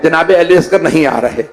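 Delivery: usual speaking voice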